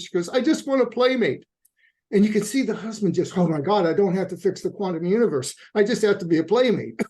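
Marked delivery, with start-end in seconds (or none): 0.3-1.5 high, loud mock-tearful plea; imitated tearful outburst. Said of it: "she breaks into tears". 3.4-7.0 imitated relief. Husband's relieved realisation, acted out.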